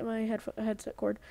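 usual speaking voice

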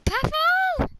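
high, loud shocked call of the name; stunned yelp of a name; shocked cry. Real, not acted. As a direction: gasp the name out in disbelief. Shocked at horse's fate.

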